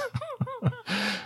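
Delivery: high, helpless burst of laughter; real laughter breaking out. Real, not acted. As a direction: crack up at the joke titles. Laughing at the fake titles.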